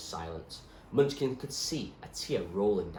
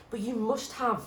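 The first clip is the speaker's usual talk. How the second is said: put-on higher, louder urgent girl; pleading girl; 3 dB louder than usual. Zoe begging the doctor to remember, higher urgent voice. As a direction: insist desperately as Zoe.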